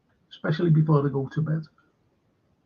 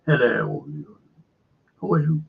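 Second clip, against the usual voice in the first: posh accent; 2 semitones lower than usual. Shown as a demonstration. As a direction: greet like royalty, posh English. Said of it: "speak like the King's language".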